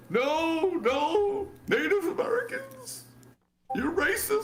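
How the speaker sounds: very high, squeaky put-on voice; hammed-up falsetto protest. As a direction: mimic an indignant activist in falsetto.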